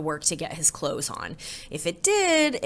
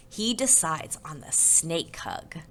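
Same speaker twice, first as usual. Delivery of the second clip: plain reading voice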